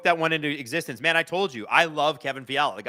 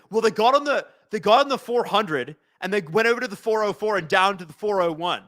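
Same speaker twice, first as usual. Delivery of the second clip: put-on higher, overdone SoCal "Californians" accent, reciting freeway routes; hammed-up Californians-skit driving directions in a SoCal accent; 4 semitones higher, 3 dB louder than usual. Answering Hayden about the Kings' route in a mock SoCal accent. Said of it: "I'm gonna speak like I'm, I'm from, uh, the hills".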